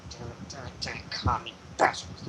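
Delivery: very quiet, low grumbled cursing; half-whispered, barely audible griping; muttering. Acted. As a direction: mutter curses under your breath. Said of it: "Nikki is muttering".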